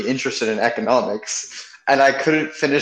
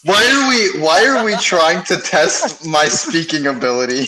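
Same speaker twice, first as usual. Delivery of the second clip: exasperated protest; 5 semitones higher than usual. Real, not acted. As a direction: protest the silly test, half laughing. Protesting the teasing tongue-twister test.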